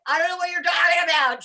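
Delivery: high, loud retold wail; distressed protest. Patient answering scolding nurse, high distressed protest. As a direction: protest loudly as a bewildered patient.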